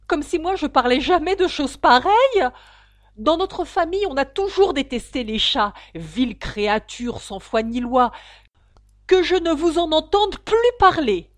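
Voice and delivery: loud, high-pitched tirade; indignant outburst. A narrator's reading. The mouse's family always hated cats, indignant outburst. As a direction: cry out in outraged disgust. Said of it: "s'écria la souris en tremblant".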